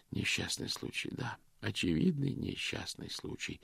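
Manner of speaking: soft, slow, almost whispered; hushed murmur. Acted. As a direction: murmur it gravely to himself. Vicar murmuring solemnly.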